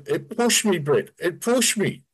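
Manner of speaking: high, panicky retelling; shaken. Retold or imitated. Friend telling Brett he was pushed off the driveway, high and panicky. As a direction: blurt it out, still scared.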